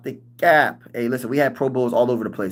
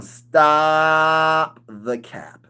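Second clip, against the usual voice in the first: drawn-out mocking dismissal; 2 semitones higher, much slower than usual. Mocking disbelief.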